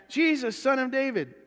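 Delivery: high-pitched insistent call; acted cry, pitched well up; persistent calling out. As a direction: keep calling for attention. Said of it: "He's gonna keep at it".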